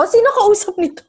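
high, loud puzzled question. Retold or imitated. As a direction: ask it as if baffled. Quoting her own puzzled thought. (nagtatakang tanong)

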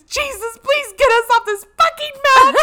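very high, screamed plea; desperate prayer. Retold or imitated. Aunt praying to get off the mountain, screaming. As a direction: shriek a hammed-up prayer for rescue. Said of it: "She's praying".

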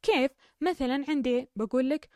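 ordinary delivery

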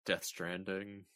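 slow, soft deadpan title; quiet, slow repeat. Acted. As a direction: murmur it slowly and low.

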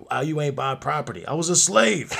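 loud, high indignant shout; hammed-up ancestral scolding; mock outraged ancestor. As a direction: build to an incredulous yell on the last word. Acts outraged ancestor for a joke.

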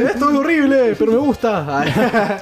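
high, stretched mock suffering; theatrical high-pitched whine; hammed-up agony. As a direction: play up the torment in a high comic wail. Acting out his tear-gas agony.

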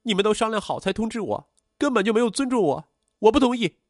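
very high-pitched, building outburst; upset, tearful protest. A narrator's reading. Daughter objects to being told last, upset and high-pitched. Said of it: "刘蕊听了大哭".

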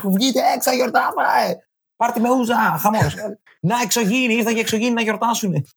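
high mock party cheer; mock celebration. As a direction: hype everyone up to party. Mock party over nine cases.

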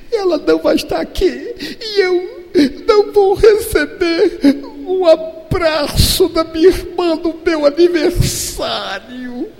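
father imitates his daughter crying, high and breaking; mock sobbing child. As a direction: wail like a heartbroken little girl.

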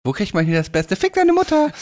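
rising to a high yelp; prank-calling teen. Pretend. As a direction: squeal the insult like a brat. Acted teen prank caller.

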